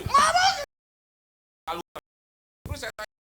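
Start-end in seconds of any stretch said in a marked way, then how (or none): 0.1-0.7 very high shrill denial, less loud; furious denial. Retold or imitated. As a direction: screech the denial again. Istri menyangkal lagi. (istri menjerit menyangkal)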